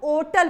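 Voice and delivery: hotel with the h dropped. Shown as a demonstration. Teacher showing students the old reading, hotel without h. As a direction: say hotel as "otel", no h. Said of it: "इसको पहले पढ़ा जाता था".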